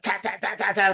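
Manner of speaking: high, light rapid-fire repeats; frantic tapping out. Retold or imitated. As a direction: imitate a panicked tap-out, high and softened. Opponent frantically tapping out acted.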